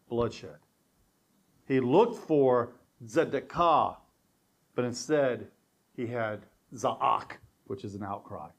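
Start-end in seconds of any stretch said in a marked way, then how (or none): 1.7-4.0 slow, "tzedakah" as tse-da-KAH; Hebrew wordplay, slow. Shown as a demonstration. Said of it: "The Hebrew here is really fun". 6.0-7.3 slow, "za'ak" with a catch between the vowels; Hebrew wordplay, slow. Shown as a demonstration. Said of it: "The Hebrew here is really fun".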